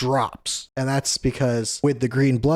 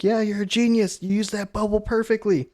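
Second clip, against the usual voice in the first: high-pitched gushing praise; mock cheering; 9 semitones higher than usual. Usopp and Lilith cheering from the background, high mock cheering. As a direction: imitate an admiring cheer squad, high but not shouted. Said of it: "are cheering him on".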